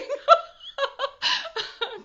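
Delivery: loud high laugh; real awkward laughter. Real, not acted. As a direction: laugh through embarrassment. Laughs after admitting crying.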